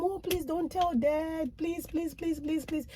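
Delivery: put-on high, hushed begging as a kid; pleading child. Kid begging mom not to tell dad, high and pleading. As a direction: plead softly like a scared child. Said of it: "So we'll beg my mom".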